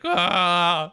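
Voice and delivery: mock pained ah. Pain of sunburn when moving.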